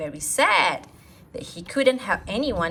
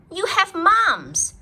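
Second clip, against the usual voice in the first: a high, loud little doctor pronouncing; doctor Ted; 12 semitones higher, 6 dB louder, slower than usual. Acted. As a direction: announce it like a proud kid doctor. Ted diagnoses the teacher.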